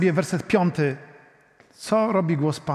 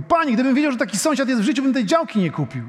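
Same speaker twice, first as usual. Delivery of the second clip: gruff neighbour; 6 semitones higher, faster than usual. Retold or imitated. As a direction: play the grumbling buyer complaining. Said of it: "to mówi".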